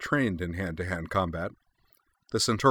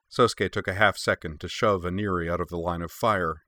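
ordinary delivery

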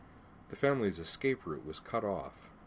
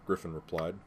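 ordinary delivery

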